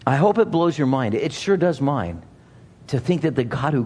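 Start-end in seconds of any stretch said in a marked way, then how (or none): none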